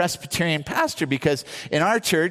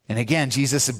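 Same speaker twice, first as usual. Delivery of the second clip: normal talking voice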